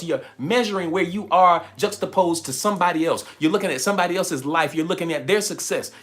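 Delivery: usual speaking voice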